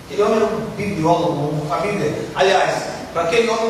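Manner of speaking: usual speaking voice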